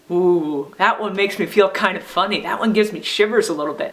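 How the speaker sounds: ordinary talk, nothing marked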